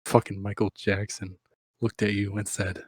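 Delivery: usual speaking voice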